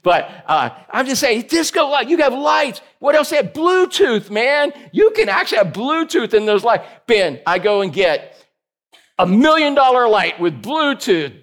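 ordinary talk

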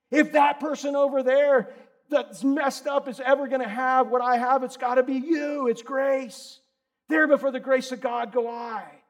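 loud, high, driving preacher voice; fiery sermon at full volume; full-force preaching. Acted. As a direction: hammer the truth home from the pulpit.

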